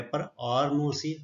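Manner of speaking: usual speaking voice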